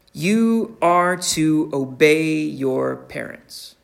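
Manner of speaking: slow, deliberate, every word weighted; solemn pulpit command; emphatic command. Acted. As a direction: lay down the command slowly and firmly. Preacher states God's command.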